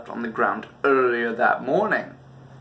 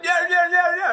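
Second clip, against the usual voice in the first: very high, loud panicky yeahs; mock frantic yelps; 3 dB louder than usual. Owner running from the chasing dog, squealing. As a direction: squeal them while running away. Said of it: "and I'm like".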